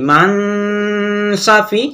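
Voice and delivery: "মান" hummed long on the n before "Safi"; nasal hold. Shown as a demonstration. Teacher modelling the nasal reading, "মান" held long. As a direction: sustain the n of "মান" through the nose. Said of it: "পরে আমরা নাকে আওয়াজ নিয়ে পড়বো".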